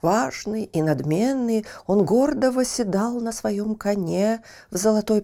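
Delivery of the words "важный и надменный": plain reading voice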